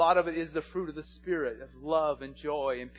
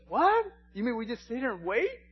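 high-pitched, disbelieving protest; incredulous child; 8 semitones higher, 6 dB louder than usual. Retold or imitated. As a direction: imitate an impatient boy who can't believe it. Acting impatient son learning about fishing.

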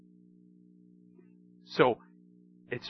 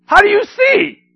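astonished neighbours; 13 semitones higher, 8 dB louder than usual. Retold or imitated. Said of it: "people that know this guy is like".

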